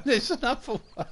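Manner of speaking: giggling through the words; laughing helplessly at another slip. Real, not acted. Streamer laughing to chat at his own slip.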